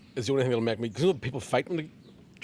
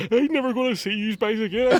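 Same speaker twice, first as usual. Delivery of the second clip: laughing mock sob; 7 semitones higher, 5 dB louder than usual. Drunk crying in toilet, retold.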